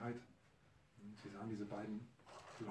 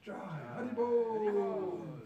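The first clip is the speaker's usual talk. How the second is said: slow, high, loud drawn-out cry; ecstatic cry; 10 semitones higher, 10 dB louder, much slower than usual. Acted. The ecstatic brothers chant, slow, high and loud. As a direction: stretch it out in rapture. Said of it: "Er war ekstatisch".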